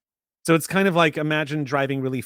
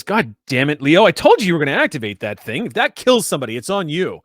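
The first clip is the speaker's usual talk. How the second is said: louder, higher, hammed-up old man's telling-off; exasperated scolding; 5 semitones higher, 7 dB louder than usual. Old man to Leo over the activated alarm, exasperated scolding. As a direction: play a fed-up coworker giving a heated ticking-off.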